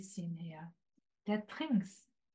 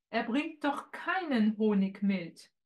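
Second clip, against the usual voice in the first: ordinary delivery, nothing marked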